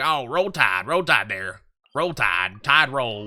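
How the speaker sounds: mock Southern twang on "roll tide"; Alabama accent. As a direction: imitate an Alabama football fan's drawl. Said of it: "an Alabama thing".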